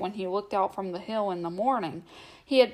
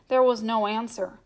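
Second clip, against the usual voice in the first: ordinary delivery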